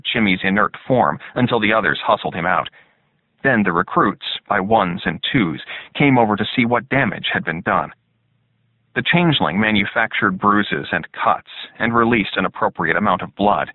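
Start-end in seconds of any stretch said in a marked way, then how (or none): none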